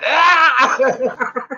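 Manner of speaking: high, loud laughing "Ah!" scream; mock kid scream. Dad mimics his son's scream, laughing. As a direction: screech like the upset kid, laughing. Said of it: "He was like".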